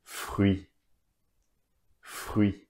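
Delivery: "fruit" the French way, silent t; French pronunciation. Shown as a demonstration. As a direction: say "fruit" with the ui glide and no final t. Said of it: "Let's pronounce that word once more".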